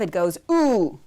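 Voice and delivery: a long, rounded "ooh" like in moon; ooh sound. Shown as a demonstration. Teacher modelling the shurek vowel "ooh" for students. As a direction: say "ooh" with lips rounded, held. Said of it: "when you punch that".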